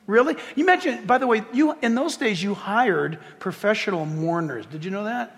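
normal talking voice

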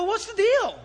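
high, loud retold bafflement; incredulous demand. Retells astonished question to boy.

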